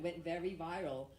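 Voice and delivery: normal talking voice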